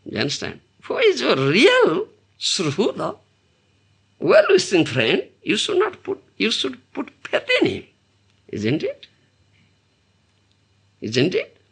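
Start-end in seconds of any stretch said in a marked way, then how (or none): none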